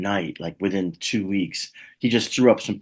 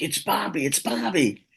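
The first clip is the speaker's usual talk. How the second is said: excited fans; 10 semitones higher than usual. Retold or imitated. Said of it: "they're like".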